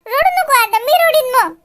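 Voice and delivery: high, loud teary pleading; tearful plea. Acted. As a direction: beg mother not to cry, voice about to break. Asks mother not to cry.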